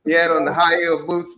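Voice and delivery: ordinary talk, nothing marked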